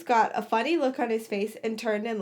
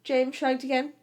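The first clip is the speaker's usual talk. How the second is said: plain reading voice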